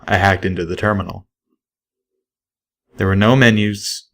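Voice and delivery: ordinary delivery, nothing marked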